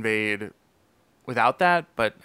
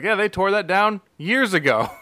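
put-on higher, breezy counter kid; mock soda jerk; 7 semitones higher, 4 dB louder than usual. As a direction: shrug off the news.

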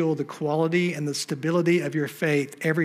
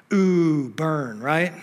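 mock ooh burn; much slower than usual. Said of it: "Man, that was a good one".

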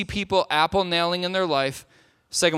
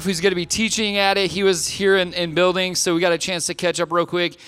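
normal talking voice

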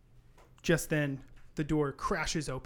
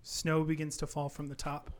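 normal talking voice

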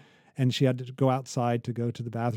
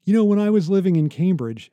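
higher, louder mock-smug name-drop; lightly lampoon a Harvard alum dropping Cambridge; 6 semitones higher, 6 dB louder than usual. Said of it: "talking with them will say".

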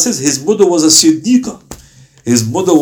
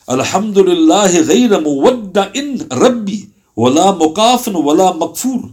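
fast flowing Arabic recitation, a little higher; reciting a dua; 5 semitones higher, much faster than usual. Acted. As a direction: rattle off the memorised prayer in one flowing stream.

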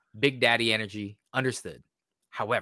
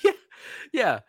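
laughing yeah; 9 semitones higher than usual. Real, not acted. Laughing agreement.

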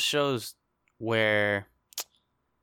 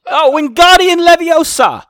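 mock wizard; 17 dB louder than usual. Jokey British spell voice.

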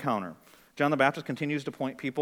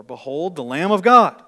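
loud proclamation; 2 semitones higher, 14 dB louder, slower than usual. Acted. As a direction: proclaim it like a herald. Voices John's public proclamation.

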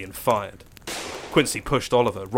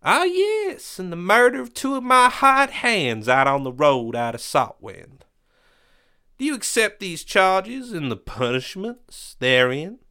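pompous villain voice; 10 semitones higher, 3 dB louder than usual. Acted. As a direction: lord it over the prisoner. Quincy continues the charges.

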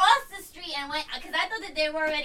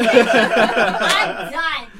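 slow, loud, laughing give-up; loud slow laughing surrender; laughing exasperation; 5 semitones lower, 12 dB louder, much slower than usual. Retold or imitated. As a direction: drag it out laughing, fed up. Retold exasperation.

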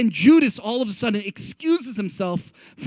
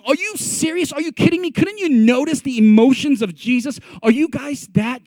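higher-pitched, exasperated disbelief; appalled, pleading rebuke; incredulous; 3 semitones higher than usual. Acted. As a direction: scold them as if you can't believe it. Jesus scolding arguing disciples, imagined.

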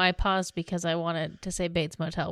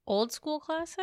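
puzzled question; 8 semitones higher than usual. Real, not acted. Puzzled echo of the clue.

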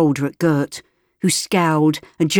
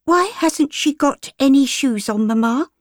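put-on high, curious little girl voice; a child asking her mamma; young girl; 9 semitones higher than usual. Little girl asks her mother, high and curious.

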